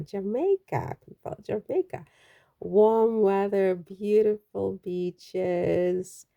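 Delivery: a light, half-hearted Jamaican lilt, slow; trying for a Jamaican accent, barely there; playful try at a Jamaican accent; attempted Jamaican accent. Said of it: "I've lost my accent".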